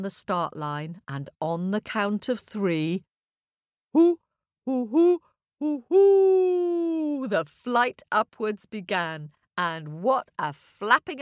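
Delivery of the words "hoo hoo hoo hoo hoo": owl countdown. A narrator's reading. Owl counts race start.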